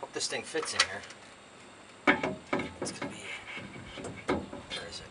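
usual speaking voice

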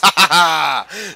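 loud gloating laugh; triumphant laugh. Real, not acted. Gamer winning the fight, loud triumphant laugh. Crowing over won fight.

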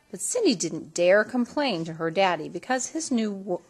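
usual speaking voice

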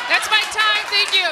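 high, loud thanks to the crowd; loud sign-off. Real, not acted. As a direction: call it out high over the audience. Comic signing off to the crowd.

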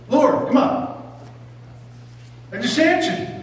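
high, pleading come-on as Peter; exasperated Peter. Acted. Peter protesting to Jesus, exasperated and high.

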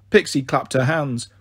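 ordinary delivery

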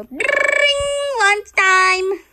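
high, loud shout; loud call. Pretend. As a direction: ring out like a school bell. School bell and call.

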